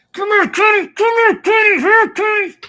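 very high pet-calling voice; calling a lost pet. Acted. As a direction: call the dog sweetly, up high. Calls the pet by name.

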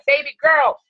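usual speaking voice

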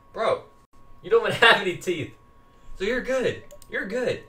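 a hint of a teasing friend; buddy voice. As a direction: answer back as a cheeky pal. Sidekick voice answering the player.

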